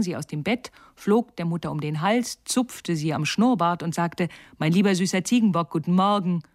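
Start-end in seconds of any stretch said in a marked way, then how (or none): 4.6-6.4 fast, loud cheeky greeting; rushed cheeky sweet-talk; teasing greeting. Acted. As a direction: gush at her playfully. Girl teases mother.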